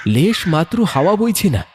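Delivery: ordinary delivery, nothing marked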